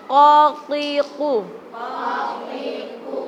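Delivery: slow qatiqu, the q from the throat; heavy qaf. Shown as a demonstration. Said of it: "kalau ko tebal". (qatiqu dengan ko tebal)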